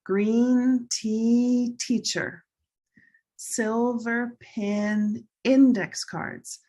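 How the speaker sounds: slow, drawn-out model phrases; pronunciation drill. Shown as a demonstration. Teacher models color words for students to say, slow drill. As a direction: stretch each key vowel for learners. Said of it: "We're gonna say".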